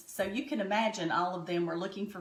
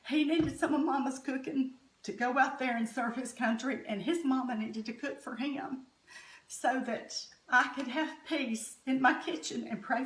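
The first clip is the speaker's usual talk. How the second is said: teary-eyed; 5 semitones higher than usual. Real, not acted. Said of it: "I'm sorry I got teary-eyed".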